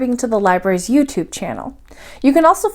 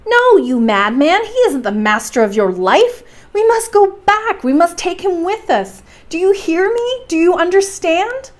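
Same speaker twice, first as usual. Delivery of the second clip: frantic pleading; 8 semitones higher than usual. Acted. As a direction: pitch climbs higher and higher, urgent. Axel frantically pleading with Hans.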